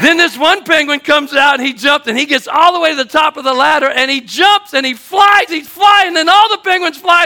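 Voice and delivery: high, loud storytelling at full pitch; breathless-with-wonder preacher's yell; excited shouting. Real, not acted. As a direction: build the tall tale to a shouted climax. Climax of penguin tall tale.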